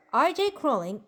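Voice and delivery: normal talking voice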